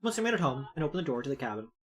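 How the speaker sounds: soft, low narrator read; quiet first-person narration voice; story narration. A narrator's reading. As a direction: read the inner monologue softly. Reading the game's narration aloud.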